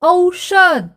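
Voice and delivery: औषध said clearly for the class; model word. Shown as a demonstration. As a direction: show how औषध sounds. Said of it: "उच्चारण देखो".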